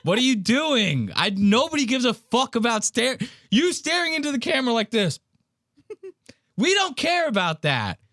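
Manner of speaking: high-pitched exasperated rant; agitated freak-out. Real, not acted. Host ranting at the vlogger's footage, agitated. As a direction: get worked up, pitch climbing way up. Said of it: "doing, like, a Ben Avery level freak out".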